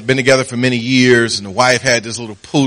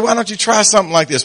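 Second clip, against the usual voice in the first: fast and high, mock-patient; wife's pointed suggestion; 9 semitones higher, much faster than usual. Wife teaches husband some tact, quick high mock patience. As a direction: lecture him briskly.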